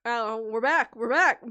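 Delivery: loud excited outburst. Real, not acted. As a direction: burst out happily. Sudden loud joke interjection.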